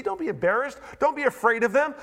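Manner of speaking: ordinary delivery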